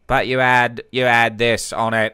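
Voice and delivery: your own voice pushed into the nose; nasal voice. Shown as a demonstration. Showing role players a voice tweak, nasal. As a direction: add a slow nasal tone. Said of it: "up in your nose".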